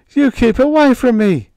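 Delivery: high and loud in panic; terrified gasp. A narrator's reading. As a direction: gasp it out in terror. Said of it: "Higgins gasped".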